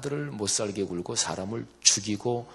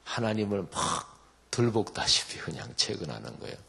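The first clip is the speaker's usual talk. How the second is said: normal talking voice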